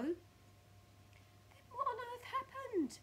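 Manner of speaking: very high, slow distressed wail; Mrs Twit crying out. Acted. Mrs Twit bewildered by her stick, high wailing cry. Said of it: "cried Missus Twit".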